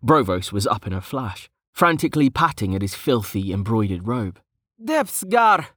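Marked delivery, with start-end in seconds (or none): 4.8-5.7 high-pitched startled yell. A narrator's reading. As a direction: yell it out in high-voiced alarm. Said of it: "he yelled".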